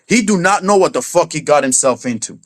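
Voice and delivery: ordinary delivery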